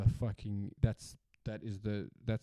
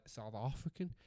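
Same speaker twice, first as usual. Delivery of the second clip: slipping into an unplaceable accent; South African in a stray, softer accent; odd accent; 10 dB quieter than usual. Real, not acted. Saying where the chocolate is made, in an odd accent. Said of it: "I don't know what accent that was".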